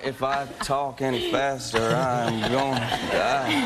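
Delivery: hammed-up, heat-drained slow drawl; slow Southern pace. Actor imitating Louisiana locals in the heat, slow drawl. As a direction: stretch each word lazily, too hot to hurry. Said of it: "there's no need to do that".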